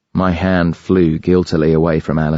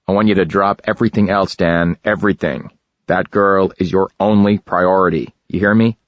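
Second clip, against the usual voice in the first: lightly voiced tense West Coast boss; a hint of Jack's tight, serious urgency. A narrator's reading. Jack on the phone orders Dan, tight and serious. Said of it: "His voice was as tight, as serious".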